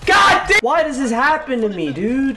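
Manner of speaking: high, loud yell easing into complaint; explode, then whine about your bad luck; frustrated outburst. Real, not acted. Frustrated outburst after dying.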